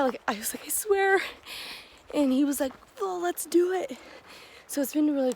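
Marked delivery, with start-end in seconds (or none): 0.7-1.2 high-pitched mock-earnest assurance; retold earnest insistence. Hiker convincing another hiker, high retold insistence. 3.0-4.0 high, imitated rallying reply; retold eager agreement. Fellow hiker agreeing to the challenge, imitated eagerness.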